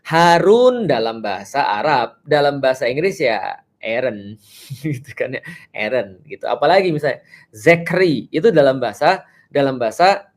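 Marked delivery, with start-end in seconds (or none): none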